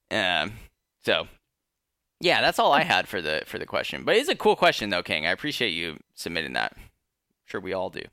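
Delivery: normal talking voice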